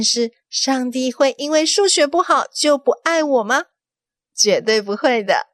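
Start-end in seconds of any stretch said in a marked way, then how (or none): none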